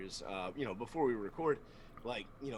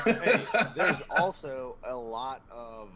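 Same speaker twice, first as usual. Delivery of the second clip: slow words through a real laugh; 8 dB louder, slower than usual. Real, not acted. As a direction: laugh as you start the line. Laughing banter among hosts.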